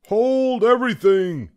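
high, loud halting shout; umpire's shout. A narrator's reading. Umpire halting the game, shouting. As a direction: stop the whole game with a holler. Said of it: "shouted the umpire".

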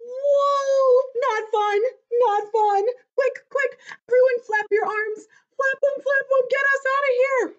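high, loud frantic squeal; playful panic. Acted. Book character urges kids to flap away, panicked cries. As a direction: cry for help in mock terror. Fleeing from the dinosaur.

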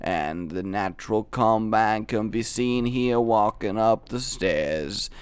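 poor impression of a low-voiced documentary narrator; Morgan Freeman. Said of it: "this is the worst Morgan Freeman impression".

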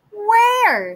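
high, eager piglet question; little pig voice. Acted.

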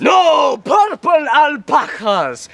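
loud slow gleeful cry; excited shout. Acted. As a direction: shout it with relish. Magician bursts out.

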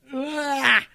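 loud, revolted ugh; disgusted groan. Real, not acted. Disgust at the creatures.

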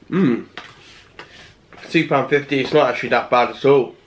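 chewing while talking; speaking between bites; mouth full. Real, not acted. Seen 3: took bite just before; head down over tray, mouth working food.

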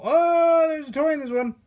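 high, loud sudden surprise; surprised. Real, not acted. Unboxer finding a toy in the goo, surprised. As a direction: blurt out the discovery.

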